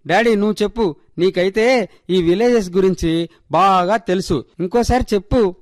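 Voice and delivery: put-on high-pitched, petulant child; miffed little girl. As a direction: sulk at mum, then press dad for an answer. Child miffed at being laughed at. (అలిగిన చిన్నపిల్ల గొంతు)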